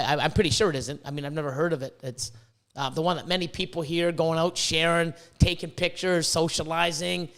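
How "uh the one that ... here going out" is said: normal talking voice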